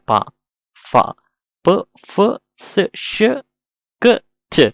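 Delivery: "ప, ఫ, స, ష, క, చ" one by one; short separate sounds with pauses between "ప, ఫ, స, ష, క, చ"; isolated consonant sounds. Shown as a demonstration. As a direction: sound out each of "ప, ఫ, స, ష, క, చ" alone. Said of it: "వేటి వేటికి అట్లా పలుకుతాం".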